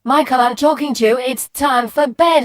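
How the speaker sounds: exasperated parent raising the voice; mum shouting. Acted. Mum to son who won't listen, shouted order. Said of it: "No need to shout".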